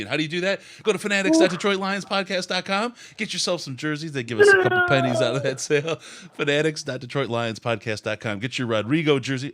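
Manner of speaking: high-pitched ad pitch; sales plug. Acted. Host plugging team jerseys to podcast listeners, high-pitched sales plug. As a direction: pitch the merchandise like an excited pitchman.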